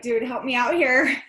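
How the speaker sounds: retold exasperation with a laugh behind it; exasperated plea. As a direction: push it out like a fed-up wife asking for help.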